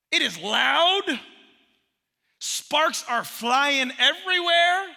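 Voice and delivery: loud, slow, every word hammered; slow emphatic preaching. Acted. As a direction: preach it with weight, drawn out.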